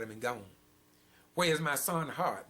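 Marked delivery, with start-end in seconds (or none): 1.3-2.5 higher, louder pleading cry; a bereaved parent's raised demand; anguished cry. Acted. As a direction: cry out like a parent robbed of a child. Grieving parent asking in sermon.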